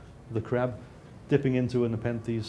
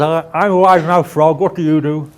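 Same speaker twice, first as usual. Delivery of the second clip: very loud, higher cartoon frog voice; wide-mouthed frog; 5 semitones higher, 16 dB louder than usual. Frog greeting a lion in a joke, loud wide-mouthed voice. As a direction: belt it out as a big-mouthed frog. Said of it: "this wide-mouthed frog's walking through".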